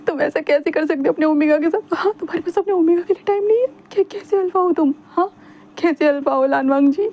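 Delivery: hurt and theatrical, pressing him; Wing accusing. A narrator's reading.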